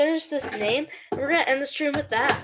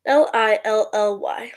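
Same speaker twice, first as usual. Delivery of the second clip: ordinary talk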